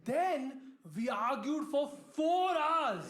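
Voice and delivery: high, drawn-out comic exasperation; exasperated outburst. Real, not acted. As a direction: push the pitch up in disbelief. Exasperated punchline about the argument.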